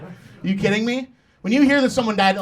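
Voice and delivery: normal talking voice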